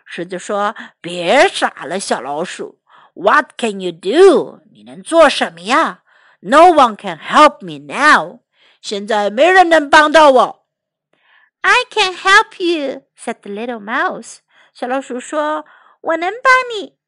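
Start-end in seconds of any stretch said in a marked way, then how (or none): 1.0-10.7 low lion voice, growing louder; dismissive lion. Acted. Trapped lion doubts the little mouse, dismissive. 11.6-13.0 high mouse voice; confident little mouse. Acted. Mouse assures the trapped lion, confident. Mouse insists it can help. 16.0-17.0 high mouse voice; confident little mouse. Acted. Mouse assures the trapped lion, confident. Mouse insists it can help.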